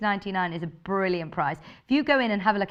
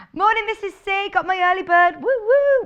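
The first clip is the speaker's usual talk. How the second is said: higher, louder cheerful hello with a whoop; excited greeting; 10 semitones higher, 8 dB louder than usual. Real, not acted.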